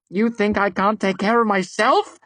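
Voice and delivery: high, loud furious tirade; raging. A narrator's reading. Devi raging at the narrator who dared bind her. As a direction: unleash her pride and rage. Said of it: "her face a mask of rage".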